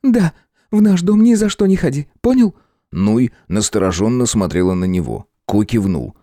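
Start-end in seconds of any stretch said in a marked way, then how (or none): 0.0-2.5 higher, insistent woman's voice; firm young woman. A narrator's reading. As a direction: press it on him. Nuy insists firmly.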